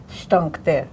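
ordinary delivery

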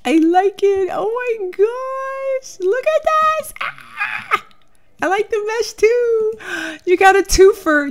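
very high delighted squeal; gushing excited squeals. Real, not acted. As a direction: squeal it up high like seeing something adorable. Gushing over cute clothes.

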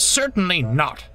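high, firm refusal; cutting interruption. A narrator's reading. Owner refusing Bulgy another chance, cutting interruption. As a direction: cut the pleading off sternly. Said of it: "interrupted the owner".

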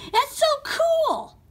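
very high, loud, stretched-out squeal; thrilled squeal. Acted. Red Yoshi marvels at creating his new voice, squealing with excitement. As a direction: squeal it with over-the-top delight.

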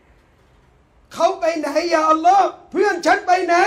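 loud, high, drawn-out cry; slow, shouted appeal; anguished plea. Acted. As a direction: call out to God for a missing friend. Believer pleads to Allah for friend.

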